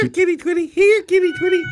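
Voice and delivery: high sing-song call, like calling a kitten; calling a cat. Pretend. Cohost teasing the host by calling a cat.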